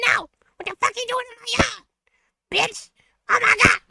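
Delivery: high-pitched mock outburst from a startled lady; mock furious homeowner, high and breathless; imitating angry lady. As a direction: squawk it up high like an angry neighbour. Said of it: "some random lady is like".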